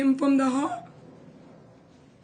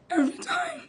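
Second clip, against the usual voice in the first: voice cracking; 5 dB quieter than usual. Real, not acted. Grief over hospitalised hunger strikers.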